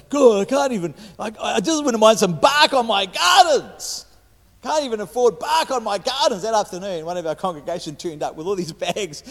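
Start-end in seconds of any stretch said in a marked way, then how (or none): 0.1-6.4 higher, louder mock complaint to God; a playful imitation of his wife griping to God, higher and louder; wife's moan. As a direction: voice the wife's exasperated grumble. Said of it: "having a little moan at God".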